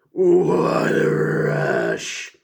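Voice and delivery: drawn-out hyped shout; slow, loud, hyped rush; excited. Acted. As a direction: stretch it out like a wrestling promo. Hyped wrestling recap exclamation.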